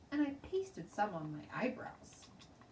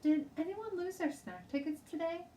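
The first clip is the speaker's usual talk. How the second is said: high, raised teacher call; teacher asking class; 12 semitones higher, 4 dB louder than usual. A narrator's reading. Teacher holding tickets up to the class, calling out. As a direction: ask the whole room firmly. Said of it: "she said to the class".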